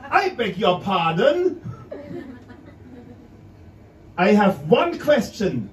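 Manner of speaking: a hint of stiff German politeness; careful German English. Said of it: "called the waitress and said".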